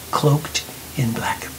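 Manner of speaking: ordinary delivery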